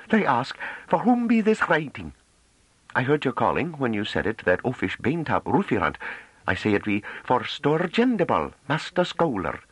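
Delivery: high, breathless voice of a shy Hamish farm woman; a nervous country girl, almost gasping; rustic young woman, high and eager; timid farm girl. A narrator's reading. Novi tells her journey.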